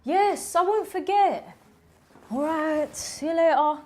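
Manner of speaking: high-pitched, put-upon replies to a parent; exasperated teenager. Acted. Teenager saying goodbye to nagging mum, exasperated. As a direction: answer Mum with drawn-out impatience, pitched high.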